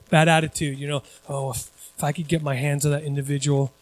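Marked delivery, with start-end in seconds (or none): 1.3-3.7 low, soft grumbling inner thought; muttered resentment. Retold or imitated. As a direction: grumble it under your breath, imitating. Voices a bad inner attitude.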